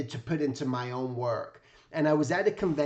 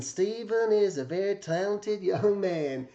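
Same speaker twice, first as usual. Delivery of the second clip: put-on slow, raised kindly old-man voice; imitating Jack Davis; 5 semitones higher than usual. Narrator voices Jack Davis's handwritten praise, slow and kindly. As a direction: read the note as the old artist would say it. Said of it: "and it just talked like this".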